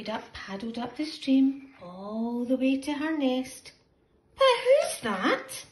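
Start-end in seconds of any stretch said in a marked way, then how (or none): 4.4-5.6 high, drawn-out who's that; high suspense question. A narrator's reading. Narrator asking the children, high and curious. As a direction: ask it high with wide-eyed suspense.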